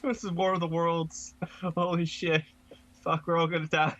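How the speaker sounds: high, panicky freak-out; panicked screaming. Pretend. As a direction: freak out like the world's ending. Acting out alien invasion panic.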